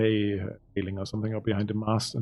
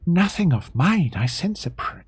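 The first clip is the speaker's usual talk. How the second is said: lightly voiced character, higher and measured; in-character reply; 6 semitones higher than usual. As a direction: answer in character with lifted, swooping pitch. Game master acts character's answer.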